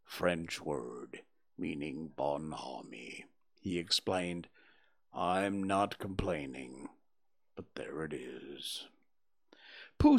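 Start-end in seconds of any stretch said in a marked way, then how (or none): 0.0-3.2 very low, slow and soft donkey; gloomy. A narrator's reading. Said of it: "went on Eeyore gloomily". 5.1-8.9 very low, slow and mournful Eeyore; gloomy donkey. A narrator's reading. Eeyore speaks.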